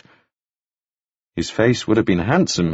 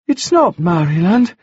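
put-on much higher, bright boyish voice; high bright young gentleman; 8 semitones higher than usual. A narrator's reading. Wounded Staines greeting Taufari, high and bright. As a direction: lift it up high and bright like a dazed young toff. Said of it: "speaking in a voice that was high and very bright".

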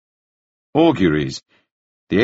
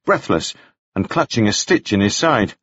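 plain reading voice

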